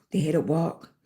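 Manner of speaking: ordinary delivery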